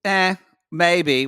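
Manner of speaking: ordinary talk, nothing marked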